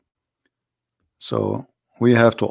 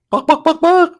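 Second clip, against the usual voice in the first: much higher, squeaky bak bak clucks; chicken clucking. Acted. Reading the chicken's saying, then clucking like a hen.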